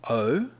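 O said alone as a clear oh; syllable demo. Shown as a demonstration. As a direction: sound out the first syllable O. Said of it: "It sounds like".

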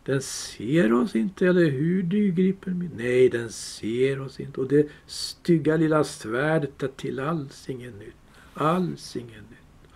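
a wheedling creature crooning to his treasure; Gollum voice. Acted. Gollum reassuring his precious, sly creature voice.

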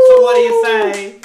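high, loud, eager question; excited ask. Real, not acted. Asking the big question, excited.

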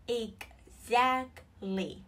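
"Exactly" very slowly, split ex-act-ly with gaps; syllable by syllable. Shown as a demonstration. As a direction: break "Exactly" into three separate beats. Teacher breaks word into syllables. (đọc chậm "Exactly", tách từng âm tiết)

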